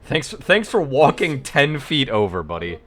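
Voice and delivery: loud, slow mock thanks; drawn-out sarcastic gratitude. Real, not acted.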